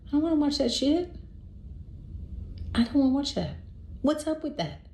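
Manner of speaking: high-pitched, put-out complaint; indignant inner protest. Retold or imitated. Host thinks this as her date puts on a cowboy movie, indignant. Annoyed thought about his movie.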